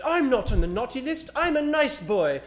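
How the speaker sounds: put-on high, loud, whiny British child's accent; mock British brat. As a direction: play a bratty British boy insisting he's a good boy, high and loud. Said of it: "the little kid with the little accent".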